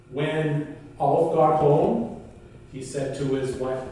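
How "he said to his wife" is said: plain reading voice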